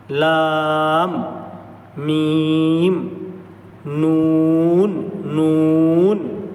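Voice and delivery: لام, میم and نون each held long; drawn-out letter demos. Shown as a demonstration. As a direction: hold each letter slowly in turn. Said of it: "بالکل simple ہے یہ".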